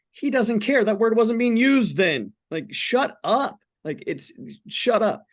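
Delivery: high, loud, impatient brush-off; dismissive outburst. Real, not acted. To Christians who police cussing, a dismissive high outburst. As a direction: wave off the critics.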